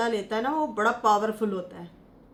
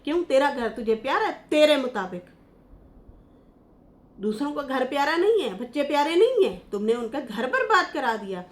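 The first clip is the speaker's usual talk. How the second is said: louder, higher reproach with punched नहीं; scolding; 4 semitones higher, 5 dB louder than usual. Real, not acted. Vlogger berates Salma over ruined homes, loud scolding. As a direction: tell her off to her face.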